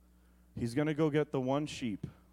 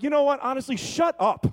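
a loud, higher burst of fed-up irritation; mock annoyed snap; 9 semitones higher, 4 dB louder than usual. Annoyed believer to a joyful coworker: loud, higher fed-up snap. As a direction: snap back like you've had enough.

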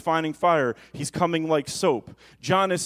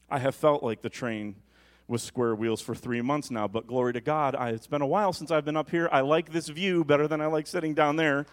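ordinary delivery

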